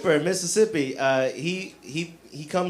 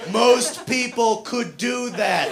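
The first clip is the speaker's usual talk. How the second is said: higher, louder, slow and defiant; louder mock yell at a tough guy; yelling back; 7 semitones higher, 3 dB louder, slower than usual. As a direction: shout your weakness at him like a boast. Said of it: "So I yell back at him".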